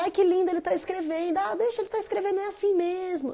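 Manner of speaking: high, soft, cooing praise; mock-sweet mum, high and soft; doting mother. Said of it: "eu podia só falar".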